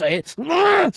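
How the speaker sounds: very high, loud anguished "ah"; frustrated cry. Real, not acted. Fan crying out in frustration over the knockout. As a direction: let out a strangled yell of frustration. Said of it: "last crash out".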